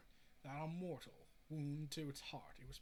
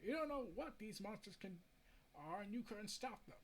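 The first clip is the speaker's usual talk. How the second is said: ordinary delivery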